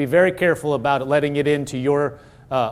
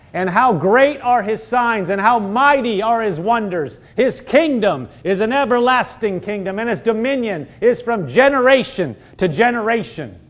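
fervent, higher-pitched proclamation of scripture; scripture reading; 5 semitones higher than usual. Real, not acted. Preacher reads the next verse to congregation, fervent proclamation. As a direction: proclaim each phrase in lifted praise. Rereads scripture aloud with fervour.

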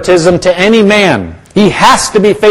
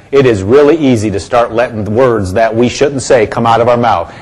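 normal talking voice

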